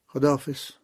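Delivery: usual speaking voice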